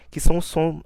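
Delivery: ordinary talk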